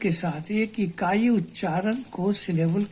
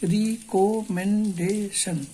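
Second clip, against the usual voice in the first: Recommendation said very slowly, syllable by syllable; broken into syllables; much slower than usual. Shown as a demonstration. Teacher breaking the word for students into strokes, very slow. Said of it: "इसको भी तोड़ के देख लीजिए".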